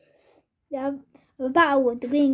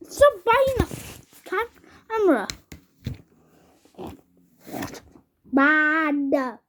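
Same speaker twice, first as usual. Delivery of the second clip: scolding the dog. Real, not acted. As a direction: stop the dog mid-bite.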